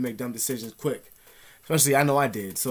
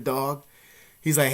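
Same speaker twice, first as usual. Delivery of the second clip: usual speaking voice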